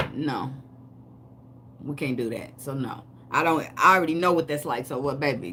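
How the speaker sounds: ordinary delivery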